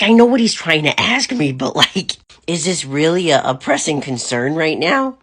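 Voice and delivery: exasperated retort. Retold or imitated. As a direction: push back, fed up but amused. Exasperated mom retold.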